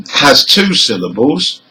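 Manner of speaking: ordinary talk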